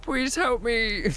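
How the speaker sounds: high, slow pretend begging for help; slow drawn-out mock plea. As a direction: stretch out the plea, hamming it up. Mock trapped, calling for rescue.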